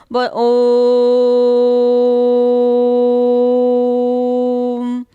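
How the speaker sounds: om held out long and loud. Shown as a demonstration. Teacher demonstrating to students, a long drawn-out ओम. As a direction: stretch ओम out for several seconds, steady and loud. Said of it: "देखिए कितने देर तक ओम का हुआ".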